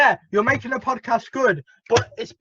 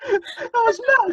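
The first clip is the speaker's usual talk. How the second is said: laughing; 4 dB louder, slower than usual. Real, not acted. Reacting to friend's squeaky laugh.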